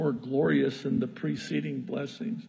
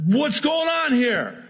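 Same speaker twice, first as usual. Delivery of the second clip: exasperated Balak; 9 semitones higher, 3 dB louder than usual. Acted. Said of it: "Balak is infuriated".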